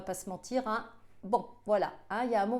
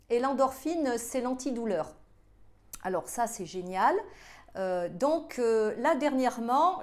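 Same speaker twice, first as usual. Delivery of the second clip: ordinary talk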